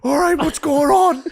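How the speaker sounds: high, loud flustered dad; a confused dad panicking up high. Retold or imitated. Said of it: "He was like".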